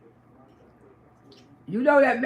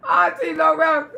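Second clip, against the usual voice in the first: ordinary delivery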